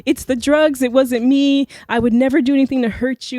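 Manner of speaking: high, pleading fake-tearful voice; mock crying. Stepfather begging Monica for forgiveness, fake tearful. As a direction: crocodile tears, begging. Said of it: "boo-hooing, crying".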